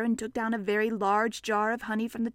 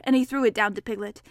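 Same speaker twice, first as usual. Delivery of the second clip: ordinary delivery, nothing marked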